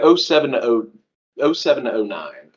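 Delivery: usual speaking voice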